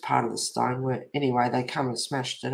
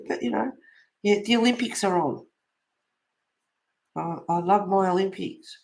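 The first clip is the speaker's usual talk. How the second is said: normal talking voice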